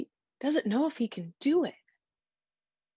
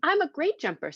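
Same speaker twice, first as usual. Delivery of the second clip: put-on confident boy; 7 semitones higher than usual. A narrator's reading. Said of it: "said Jabari".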